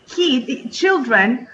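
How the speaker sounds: ordinary talk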